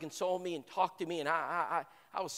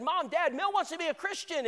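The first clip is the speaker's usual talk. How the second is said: high, louder, bursting with news; overjoyed announcement; 12 semitones higher, 5 dB louder than usual. Retold or imitated. Son telling his parents his friend will convert, overjoyed. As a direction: call out the good news to your parents. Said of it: "I'm such a high".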